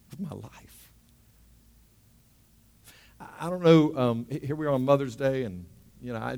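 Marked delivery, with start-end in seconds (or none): 0.1-0.9 whispered echo of the line; hushed, reverent whisper. Acted. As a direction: breathe it out like a secret. Repeating Jesus' words, hushed.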